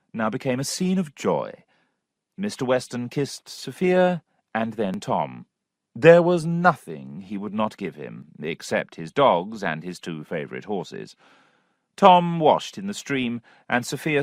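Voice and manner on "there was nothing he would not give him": ordinary delivery, nothing marked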